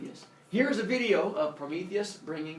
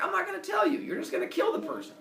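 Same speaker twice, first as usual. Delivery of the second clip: high, loud protest; hammed-up indignant refusal; defiant refusal; 3 dB louder, faster than usual. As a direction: protest in a pitched-up character voice. Prometheus refuses Zeus.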